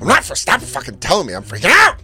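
loud, high mock blow-up; angry outburst. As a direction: suddenly explode in protest, acting it out. Acted blow-up at being told.

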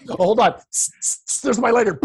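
usual speaking voice

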